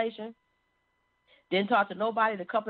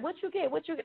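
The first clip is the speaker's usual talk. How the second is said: put-on high, hushed child voices asking eagerly; little kids asking; 10 semitones higher, 5 dB quieter, faster than usual. Children asking mom about her grade, in high hushed voices. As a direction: imitate small children pestering mom with a question.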